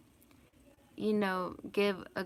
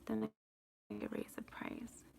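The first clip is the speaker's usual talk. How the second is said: whispered aside; 10 dB quieter than usual. Real, not acted. Aside while checking prices.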